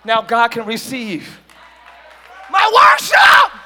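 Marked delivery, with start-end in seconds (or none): 2.5-3.5 high, slow, drawn-out shout of worship; preaching climax shout. Real, not acted. Preacher to the congregation, a soaring slow shout. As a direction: stretch it out and lift the roof.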